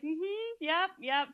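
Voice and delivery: imitating strained smile-and-nod assent; pained politeness. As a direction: nod along while hiding the pain. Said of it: "trying to hide the pain on his face".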